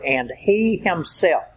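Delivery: slow, leaning hard on "he"; "he" stretched and weighted, "himself" trailing; stress on he. Shown as a demonstration. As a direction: press down on "he" in "and he himself". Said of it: "there is a double emphasis on this one word".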